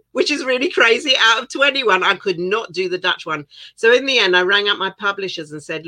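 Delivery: ordinary delivery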